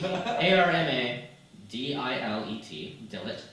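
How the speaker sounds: slow spelling. Shown as a demonstration. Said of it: "let's spell it out for everybody".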